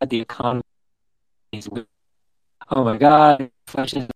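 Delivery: words fading and breaking up; call dropping out. Real, not acted. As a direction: voice cutting out on a bad line. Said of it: "you're breaking up".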